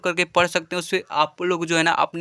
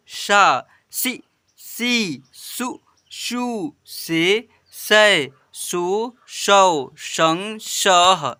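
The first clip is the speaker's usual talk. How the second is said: slow, drawn-out chant of each syllable; syllable drill; much slower than usual. Shown as a demonstration. Teacher reading the chart to children, slow drawn-out recitation. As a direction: recite each letter clearly for pupils to repeat.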